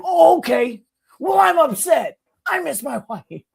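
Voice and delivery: high, loud, wounded mock shout; non-stop yelling. Neighbor won't stop venting at girlfriend, mock yelling. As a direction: keep yelling as the upset neighbor. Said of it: "he wouldn't stop yelling".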